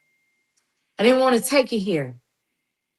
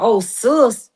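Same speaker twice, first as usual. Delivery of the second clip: mocking quote; slower than usual. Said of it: "for you to sit up here and talk about".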